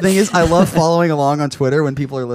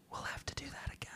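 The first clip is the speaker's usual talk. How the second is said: quick breathy whisper; 27 dB quieter, faster than usual. Acted. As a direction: whisper it fast into an ear. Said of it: "whispering something in his ear".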